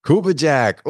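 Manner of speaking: trying the nickname out, pleased; pleased echo. Real, not acted. Co-host echoes the guest's nickname, pleased. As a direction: repeat Cuba Jack with a lift of delight. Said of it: "Ooh, see?".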